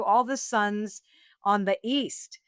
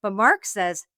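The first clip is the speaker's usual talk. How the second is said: ordinary talk, nothing marked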